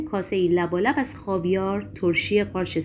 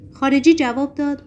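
ordinary delivery, nothing marked

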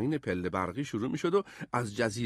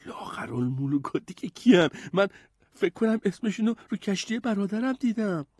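higher, piping puzzled voice; curious, raised young hero's voice; raised-voice question; 9 semitones higher than usual. A narrator's reading. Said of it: "با صدای بلندتری پرسید".

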